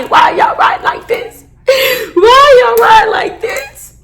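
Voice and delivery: half-laughing, half-sobbing cry at relatives; drunk crying shout. Retold or imitated. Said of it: "So I'm crying".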